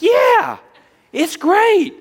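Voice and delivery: high, loud, exaggerated sarcastic "great"; mock sarcastic yes. [name]'s sarcastic answer.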